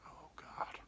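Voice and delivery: soft, breath-only groan of dread; acted hushed dismay; whispered dismay.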